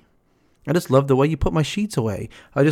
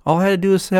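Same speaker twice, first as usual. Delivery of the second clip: ordinary talk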